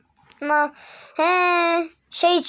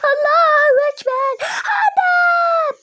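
much higher, louder panicked yelling; alarmed shout; 6 dB louder, slower than usual. Real, not acted. Seeing smoke rise from the pan, alarmed shouting.

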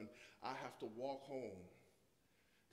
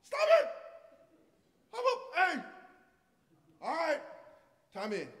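high, loud shouting across the court; yelling at brother; 13 semitones higher, 14 dB louder than usual. Retold or imitated. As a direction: yell over like a big brother. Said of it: "and I go".

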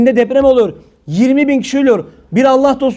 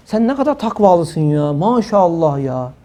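sarcastic mock praise; 6 semitones lower, 3 dB quieter, slower than usual. Sarcastic retort to a critic.

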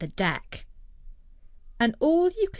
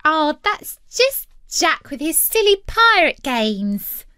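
put-on higher-pitched girl's voice; scoffing sister; 7 semitones higher, 4 dB louder than usual. A narrator's reading. Sister dismisses Jack's pirate games, in girlish voice. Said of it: "said one of his sisters".

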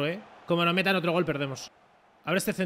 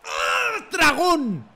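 high, loud cry; anguished shout; 9 semitones higher, 6 dB louder, much slower than usual. Real, not acted. Player crying out to teammate Dragón, anguished shout. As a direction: yell out at the agonising miss. Cries out at missed chance.

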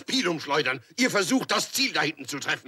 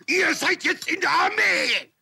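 shout; 10 semitones higher, 3 dB louder than usual. Acted. Drill sergeant addressing recruits.

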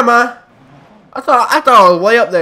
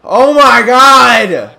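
exasperated cry; 4 semitones higher, 7 dB louder, much slower than usual. Real, not acted. Exasperated at game.

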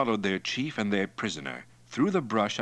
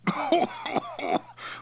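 high, airy puffs after strong drink; gasping wheeze. Acted. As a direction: wheeze out the burn in short bursts.

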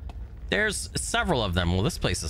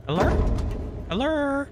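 high call. Real, not acted. Calling out in empty monastery.

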